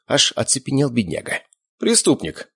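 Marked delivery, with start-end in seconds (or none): 1.8-2.5 higher, abrupt one-word answer; brusque reply; curt. A narrator's reading. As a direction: answer sharply. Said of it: "резко ответил я".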